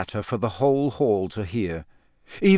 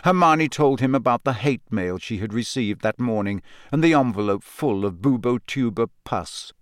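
plain reading voice

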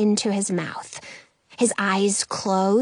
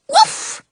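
high yappy woof; dog bark; 4 dB louder than usual. A narrator's reading. Dog barks.

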